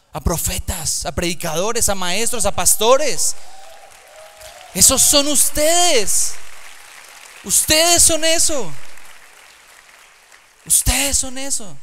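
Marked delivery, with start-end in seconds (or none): 4.7-8.7 high, loud, slow proclamation; emphatic declaration. Real, not acted. Preacher declaring to the young people, ringing loud and high. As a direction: nail it home to the crowd, slow and loud.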